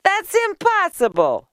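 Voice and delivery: high, loud disbelief; high-pitched, scornful exclamation; scoffing. A narrator's reading. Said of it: "scoffed Leah".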